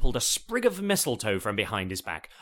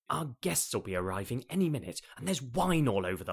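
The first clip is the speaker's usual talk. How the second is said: plain reading voice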